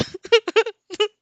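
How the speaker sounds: loud giggle; high laugh burst. Real, not acted. Narrator laughing at the yakuza line, loud giggle.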